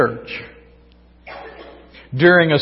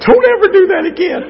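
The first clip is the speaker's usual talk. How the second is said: mock kid voice; 6 dB louder, faster than usual. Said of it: "The kid said".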